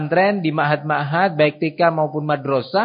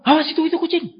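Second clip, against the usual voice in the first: high-pitched imitated alarm; mock scary warning; 12 semitones higher, much faster than usual. Parent scaring a child with a passing cat, mock alarm. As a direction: warn of danger to frighten a child. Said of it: "ditakut-takuti".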